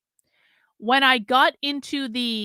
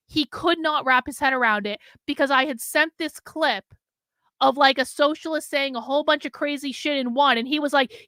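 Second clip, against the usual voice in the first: ordinary delivery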